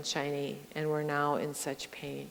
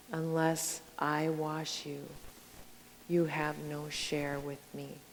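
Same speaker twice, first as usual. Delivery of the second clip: softer, slow and tender; quiet, gentle Jesus; 3 semitones lower, 10 dB quieter than usual. Retold or imitated. Jesus answering Peter, soft and tender. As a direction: say it as a gentle invitation. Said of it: "Jesus might sound, sound stern".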